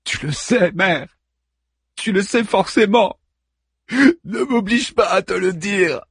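lightly voiced moaning hero; moaning plea. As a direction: beg her not to make you say it. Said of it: "Achille gémit de plus belle".

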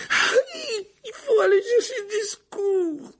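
high, slow, panicked plea; frightened. Acted. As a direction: beg for help in terror. Hermione pleads for help.

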